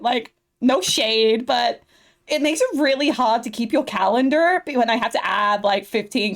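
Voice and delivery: put-on bubbly Valley Girl assistant. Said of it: "doing that Valley Girl voice".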